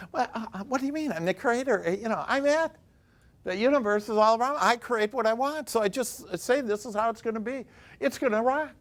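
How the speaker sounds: playing the smug creator; mock know-it-all. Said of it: "or, you know".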